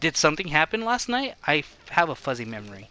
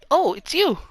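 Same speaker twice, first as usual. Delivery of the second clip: surprised recognition; 12 semitones higher, 5 dB louder than usual. Acted. Character recognizes visitor.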